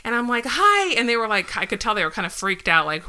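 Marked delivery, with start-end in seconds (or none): 0.5-0.9 high loud hi to strangers; high call out. Retold or imitated. Wife calling out to a passing couple at night, high and loud. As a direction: sing out a bright hi to strangers.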